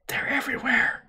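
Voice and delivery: rasping whispered warning; hissed whisper. A narrator's reading. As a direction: hiss it like a man on a respirator. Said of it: "he hissed".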